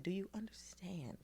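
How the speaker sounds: unvoiced, hushed question; whisper. Teacher privately checking on a student, whispered. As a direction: whisper it so nobody hears. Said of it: "she said".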